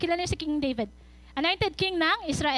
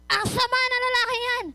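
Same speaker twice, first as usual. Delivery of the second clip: angry; 5 semitones higher than usual. Retold or imitated. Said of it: "galit na galit siya".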